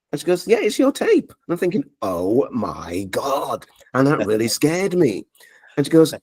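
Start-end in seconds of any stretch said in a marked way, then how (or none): 0.5-1.3 lightly voiced woman, pitched much higher; a hint of a chirpy shopkeeper; friendly shop assistant, played light. 2.0-3.7 slow, drawn-out, hushed oh my God; stunned murmur to himself; awed dread. Retold or imitated. Scared by hearing his own tape.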